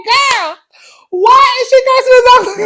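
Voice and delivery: high, loud shriek through laughter; laughing shriek. Retold or imitated. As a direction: scream the question while laughing hard. Retelling her own shocked reaction.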